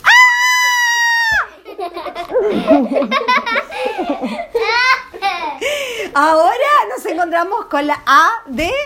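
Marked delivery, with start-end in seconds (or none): 0.0-6.1 higher laughing scream; playful fright scream; mock horror scream. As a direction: shriek then laugh at the scary scene. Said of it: "película de terror".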